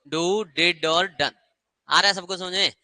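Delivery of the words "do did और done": slow spelled-out list. Shown as a demonstration. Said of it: "do की form क्या होती है?".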